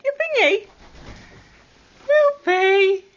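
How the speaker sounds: ordinary talk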